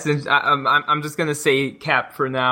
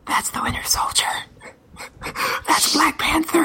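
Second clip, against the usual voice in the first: put-on excited kid's stage whisper, rising high; whispering kids; 14 semitones higher than usual. Kids behind narrating the movie to each other, whispered. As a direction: whisper it like a kid narrating a film. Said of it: "whispering to each other".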